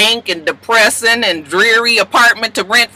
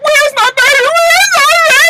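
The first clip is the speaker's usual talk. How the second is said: much higher and louder, panicked cry; frantic; 6 dB louder than usual. Retold or imitated. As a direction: scream for the missing baby in panic. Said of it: "she's getting more and more frantic".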